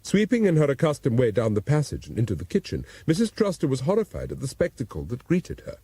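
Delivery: ordinary delivery, nothing marked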